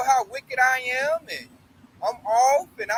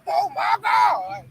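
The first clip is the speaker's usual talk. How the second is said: imitating grandma's long, high gasp of disbelief; shocked grandma impression; mock shock; 7 semitones higher, much slower than usual. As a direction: draw it out high and slow, scandalized. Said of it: "she be in a state of shock".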